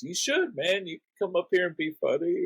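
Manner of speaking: imitating a comic coaxing someone onto the stage; encouraging comic. Comic on stage riffing at kid.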